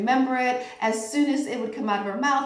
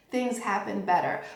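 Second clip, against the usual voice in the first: usual speaking voice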